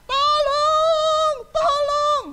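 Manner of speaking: high, loud sung cry of tolong; child screaming. Acted. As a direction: wail like a frightened child. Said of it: "Anak sampai teriak-teriak". (anak menjerit minta tolong)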